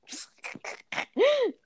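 a high burst of laughter. Real, not acted. Laughing at herself as a teen, a high burst. Laughing at her teenage self.

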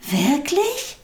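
high, rising cry of hopeful surprise; excited cry. A narrator's reading. Little soul offered help by friendly soul, surprised cry. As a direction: call it out as an amazed question. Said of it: "rief die kleine Seele".

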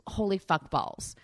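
normal talking voice

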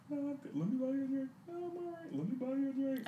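high voice back and forth; high-pitched mock exchange. Acted exchange at a bar.

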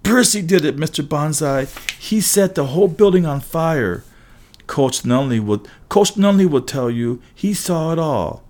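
stumbling over the words in a panic; terrified. A narrator's reading. Matt Sloan blaming Percy to the headmaster, high panicky blurt. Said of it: "a look of terror".

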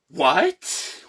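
high, rising disbelief; incredulous reaction. Retold or imitated. Patient's silent reaction to the dentist, incredulous. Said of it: "instantly in my mind".

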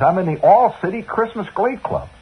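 put-on high, fast, breathless boyish babble; eager boy, high and rushing; excited kid. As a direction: gush it all out to mom. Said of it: "I says".

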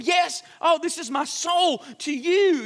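high, overdone heartfelt claim; mock-sincere husband insisting on the card; hammed-up earnestness. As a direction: lay it on thick like a lovestruck husband. His earnest insistence, retold.

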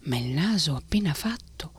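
usual speaking voice

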